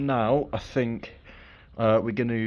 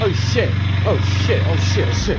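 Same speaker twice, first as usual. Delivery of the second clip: loud, high, alarmed repeated curse; panicked yelling; 7 semitones higher, 10 dB louder than usual. Real, not acted. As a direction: panic as it goes wrong. Fuel leaking, panic.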